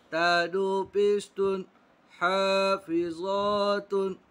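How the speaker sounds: slow, drawn-out recitation of syllables; Quran spelling drill. Shown as a demonstration. Teacher drilling pupils in Quran spelling, slow chant. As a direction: spell it out syllable by syllable, stretching long vowels.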